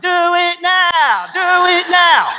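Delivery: loud, slow, high chant; egging shout. Retold or imitated. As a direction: chant it like a fan at a fight. Egging on the fight.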